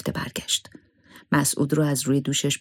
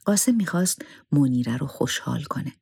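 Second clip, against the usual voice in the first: usual speaking voice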